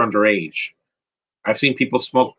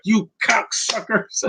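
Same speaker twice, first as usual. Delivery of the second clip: high, drawn-out imitation of an angry dad; mock angry outburst; 11 semitones higher, slower than usual. Travolta's Gotti disciplining his sons, mock angry. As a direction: stretch out a squeaky mock scolding, much higher. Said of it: "He's like".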